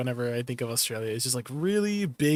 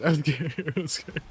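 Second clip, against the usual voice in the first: laughing mock fear. Real, not acted.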